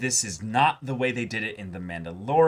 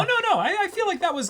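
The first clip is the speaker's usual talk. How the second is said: much higher, loud disbelieving no; surprised protest; 4 dB louder, faster than usual. Real, not acted. Host objecting to co-host about Fennec, high surprised protest. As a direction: jump in to disagree.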